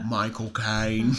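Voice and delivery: "My cocaine" drawn out slowly in a low Michael Caine Cockney accent; slow, low Michael Caine impression of "My cocaine". Shown as a demonstration. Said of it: "in Michael's Caine".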